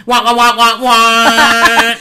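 high, loud trombone-like wah wahs; like a muffled cartoon grown-up; muffled wah wah talk. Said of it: "I'll do what Andrea always says".

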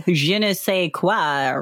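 put-on slow, playful French accent on Je ne sais quoi; a teasing French accent, slowed down; mock French accent. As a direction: drawl it out in a hammed-up French accent. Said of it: "French accent".

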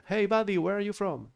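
voiced up high, chatty stranger; playful voice for an English-speaking teammate; high-pitched foreign gamer voice. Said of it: "me dicen por voice".

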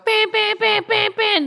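high, steady imitated alarm beeps; monitor beeps. Patient imitating the heart monitor alarms, high steady beeps. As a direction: mimic a beeping hospital monitor.

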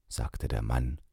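usual speaking voice

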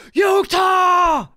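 long, high holler imitating the fans; hype shout. Podcaster imitating the hyped chat, drawn-out high shout. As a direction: shout it with the last vowel held. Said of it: "You always hear".

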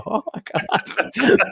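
breathless high whisper of panic; scared whisper. Retold or imitated. Friends hiding at a window, whispering in panic. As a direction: gasp it out under your breath, terrified of being seen.

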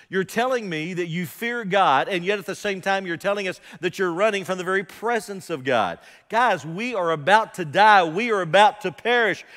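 loud, high, frantic cry of doom; panicked sailors' shout. Acted. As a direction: shout it like the ship is sinking. Sailors' panicked accusation acted out.

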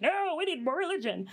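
hint of preachy settlers; mock Protestants. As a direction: lightly parody zealous settlers. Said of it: "the Protestants are like".

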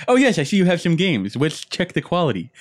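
high, loud mock nerd. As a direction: imitate a nerd haggling back. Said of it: "rebuttaling with the same voice".

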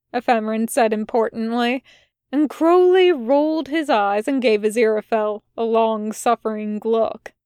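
ordinary delivery

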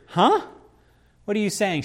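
imitating a puzzled listener's disbelief; baffled surprise. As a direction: sound caught off guard, not believing it. Listener baffled by a strange claim.

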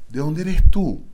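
loud, high, astonished question in a foreign voice; surprised foreigner. Retold or imitated. Foreigner abroad asks the singer where he's from, loud and high. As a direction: play the stunned foreigner asking where he's from. Foreigners asking his origin.

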